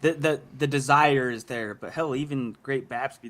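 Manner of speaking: usual speaking voice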